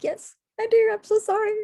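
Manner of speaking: high, loud imitation of tearful apology; acted-out tearful squeal; retold crying. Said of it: "You know, I was crying".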